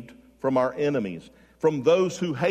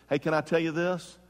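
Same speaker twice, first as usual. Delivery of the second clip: ordinary talk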